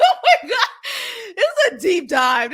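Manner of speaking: high, laughing oh my God; laughing in disbelief. Real, not acted. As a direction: laugh through the words. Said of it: "I'm sorry".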